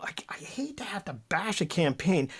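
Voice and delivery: hushed, confiding whisper; whispered aside. Real, not acted. Panelist whispering before criticising the O'Toole campaign. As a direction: whisper it like a reluctant confession.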